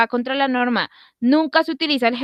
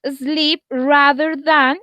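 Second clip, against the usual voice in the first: very slow, higher, careful English; slow model sentence; 4 semitones higher, much slower than usual. Shown as a demonstration. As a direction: draw it out for the class. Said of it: "teacher models structure slowly".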